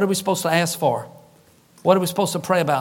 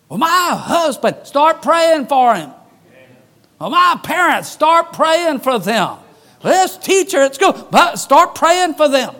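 forceful preaching; 6 semitones higher, 4 dB louder than usual. Acted. Preacher rebutting excuses, urging prayer.

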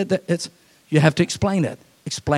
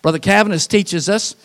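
ordinary talk

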